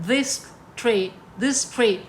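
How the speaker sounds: slow "this tray", each syllable set apart; word deliberately cut short. Shown as a demonstration. Teacher splitting traitor across feet, "this tray" in slow separate beats. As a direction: break off after "tray", leaving the word unfinished. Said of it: "इसको पूरा नहीं पढ़ना".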